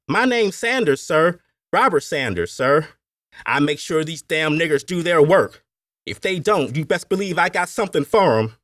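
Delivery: high, loud Appalachian overseer; Appalachian accent. A narrator's reading. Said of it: "he said in his Appalachian accent".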